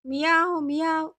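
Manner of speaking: narrator's imitated cat meow. A narrator's reading. Father slinking away from angry wife, imitated cat meows. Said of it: "పిల్లిలా బాత్రూంలోకి వెళ్ళిపోయాడు".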